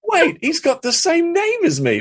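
high, slow, stunned voice; squeaky disbelief. Retold or imitated. To the audience, seeing his own name on screen, high stunned disbelief. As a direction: play your own brain short-circuiting. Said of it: "and I'm going".

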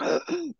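quick high gasp for air; sharp gasp. Retold or imitated. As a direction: gasp as if choking. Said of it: "she was like".

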